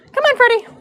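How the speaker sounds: high coaxing call to the horse. Real, not acted. Braider coaxing her horse to move, high and coaxing. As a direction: sweet-talk the horse forward.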